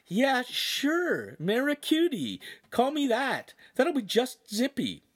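high, heavily sarcastic agreement; exaggerated fake enthusiasm; unsubtle, vengeful tone. A narrator's reading. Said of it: "he said with an unsubtle tone".

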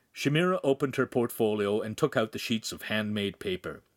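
usual speaking voice

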